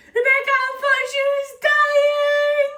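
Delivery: high, loud, sing-song wail of despair; hysterical. Retold or imitated. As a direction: whine to her friend that the bunny is dying. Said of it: "And I was like".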